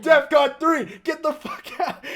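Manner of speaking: high, slow mock alarm; mock alarm shout. Parents ordering retreat, mock alarm shout. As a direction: call it like an emergency. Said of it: "my parents were all like".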